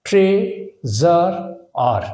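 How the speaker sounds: Treasurer slowly as tray, জার, আর; treasurer drawn out piece by piece; syllable by syllable. Shown as a demonstration. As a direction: pause between the three parts of treasurer. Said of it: "তাহলে কিভাবে করলাম?".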